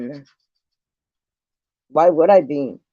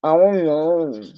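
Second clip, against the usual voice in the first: lightly voiced dopey shrug of a reply; a hint of mocking the other woman; mocking her clueless answer; slower than usual. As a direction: parrot her I-don't-know with mild mockery. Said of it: "That's all she gotta say".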